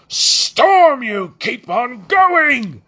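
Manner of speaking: loud, drawn-out high shout; bellowing. Acted. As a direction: roar at the crew to keep going. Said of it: "Gaz bellowed".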